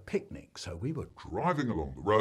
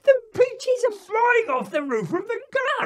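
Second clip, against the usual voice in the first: put-on high, frantic child's shriek; calling out; 9 dB louder than usual. A narrator's reading. Joe to dad about flying peaches, frantic calling out. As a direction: yell in panic at the top of the voice. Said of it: "So Joe is calling out".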